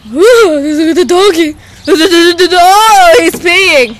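loud, delighted exclamation; excited. Real, not acted. Pointing out a dog to a friend, excited. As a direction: exclaim it loudly with drawn-out oohs.

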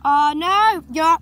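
usual speaking voice

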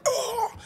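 high-pitched "oh!" yelp; startled cry. Retold or imitated. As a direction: act out a sudden shock. Acts out reaction to back cracking.

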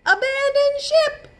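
high, loud captain's order. Acted. Child captain orders crew, high loud shout. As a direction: shout the order to abandon ship.

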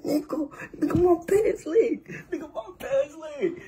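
high, strained mock panic with a laugh; frantic high-pitched pleading; panicked plea. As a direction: beg in a squeaky high voice. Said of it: "I'm like".

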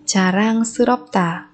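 usual speaking voice

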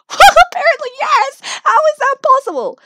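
high, loud disbelief; loud, high-pitched amazement; incredulous. Acted. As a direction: exclaim it in astonishment. Baffled at being evicted from jail.

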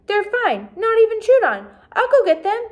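high, loud hurried reassuring; eager reassurance. A narrator's reading. Girl reassuring her upset mother, high and loud. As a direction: rush to smooth it over.

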